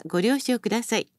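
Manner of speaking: ordinary delivery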